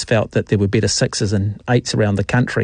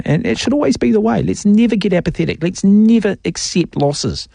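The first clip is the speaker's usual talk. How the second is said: rallying call; 7 semitones higher than usual. Real, not acted. Passionate rallying against losing.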